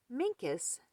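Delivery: ordinary delivery, nothing marked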